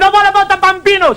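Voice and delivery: high hammed-up Italian wife. Wife calling after her husband, high comic Italian accent. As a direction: plead like a comic Italian mamma. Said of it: "She said".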